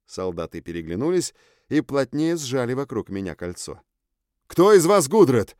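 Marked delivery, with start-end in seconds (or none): 4.5-5.5 loud, high demanding question. A narrator's reading. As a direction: call it out loud to the group. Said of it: "громко спросил я".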